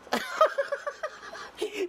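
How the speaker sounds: high, bursting real laugh; burst of laughter. Real, not acted. Laughing when the finger lands on the name.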